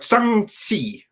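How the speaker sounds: "Shang-Chi" said as roughly "shung-tsee"; demonstrating pronunciation. Shown as a demonstration. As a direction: sound out "Shang-Chi" with a hissed "see" ending. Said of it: "that's how it is supposed to be pronounced".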